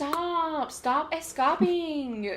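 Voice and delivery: high, drawn-out mock scolding; scolding eyelids. Eyelids ordering the eyes to stay put, mock scolding. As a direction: drag out the order like an exasperated body part. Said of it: "They said".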